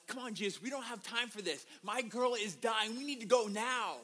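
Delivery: acted parental urgency; urgent pleading father. Dying girl's dad urges Jesus on.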